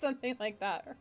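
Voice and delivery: laughing through the words; cracking up mid description; giggling at own narration. Real, not acted.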